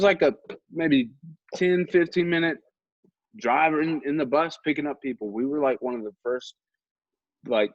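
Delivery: normal talking voice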